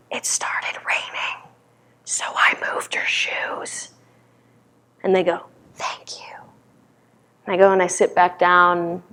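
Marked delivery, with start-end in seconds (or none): 0.1-3.9 slow, voiceless whisper. Retold or imitated. Confessing to a friend in the silent hall, slow whisper. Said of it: "and so I whisper". 5.8-6.4 barely audible whisper; whispered thanks. Retold or imitated. Friend replying in the silent meditation hall, very soft whisper.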